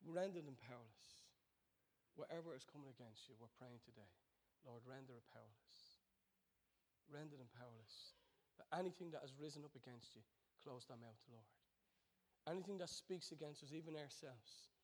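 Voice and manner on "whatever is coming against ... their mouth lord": soft prayer. Acted.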